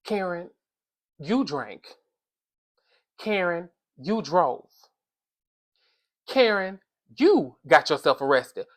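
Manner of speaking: hushed, slow reproach with long pauses; low, soft, let each accusation land; grave scolding. Acted. Solemn scolding of absent Karen.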